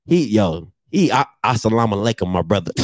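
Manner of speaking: usual speaking voice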